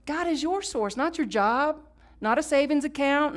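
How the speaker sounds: ordinary talk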